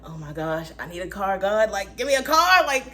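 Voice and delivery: rising to a high loud plea; hammed-up desperate begging; mock begging. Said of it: "I'm just begging, like".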